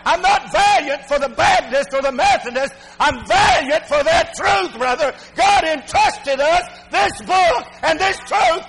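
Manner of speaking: high, impassioned sermon delivery; fervent, rising to a high peak; full-force preaching. Real, not acted. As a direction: declare your conviction from the pulpit.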